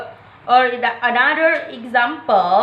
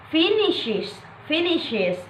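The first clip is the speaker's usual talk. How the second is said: "finishes" stressed on FIN, rest unstressed; first-syllable stress; 4 semitones higher, 6 dB quieter, slower than usual. Shown as a demonstration. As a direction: hit the FIN of "finishes", then fall away. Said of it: "primary stress is on the first syllable".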